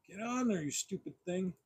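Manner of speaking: under-the-breath exasperation while fiddling; frustrated grumble. Real, not acted. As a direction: quietly scold a stubborn little part. Struggling to attach a toy accessory.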